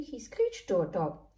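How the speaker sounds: usual speaking voice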